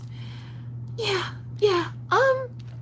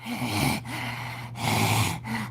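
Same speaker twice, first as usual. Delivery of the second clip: weary sigh; 9 semitones lower, 7 dB quieter than usual. Retold or imitated. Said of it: "I'm like".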